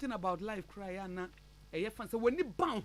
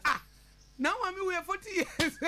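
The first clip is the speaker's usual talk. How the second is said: higher, slow incredulous echo; mock astonishment; 7 semitones higher than usual. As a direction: repeat it as if astonished. Astonished reaction to the same remark.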